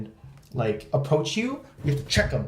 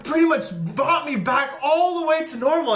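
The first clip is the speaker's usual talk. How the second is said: much higher, loud power-up shout; mock anime hero voice, much higher and louder; anime hype; 13 semitones higher, 4 dB louder than usual. Hyped anime power-up bit.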